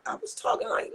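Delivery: I was talking like, squeezed way up high like a hurt voice; pushing the words out through an injured throat; damaged strained voice. Shown as a demonstration. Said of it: "literally talking like that".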